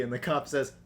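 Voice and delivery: ordinary talk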